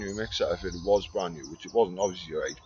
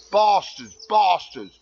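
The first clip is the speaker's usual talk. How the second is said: higher, louder bastards, hammed up; mock outraged shout; 8 semitones higher, 6 dB louder, slower than usual. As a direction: shout it in fake indignation at the firemen.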